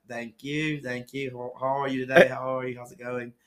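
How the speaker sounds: chirpy fake English greeting; mock-English pleasantries; put-on English accent. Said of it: "not even a really good accent".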